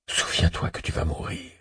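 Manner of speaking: chuchotement sans voix, menaçant; chuchoté. Acted. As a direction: souffle la devise comme un secret mortel. Devise macabre murmurée par le tueur. (chuchoté, presque sans voix)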